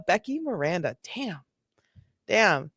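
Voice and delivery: ordinary talk, nothing marked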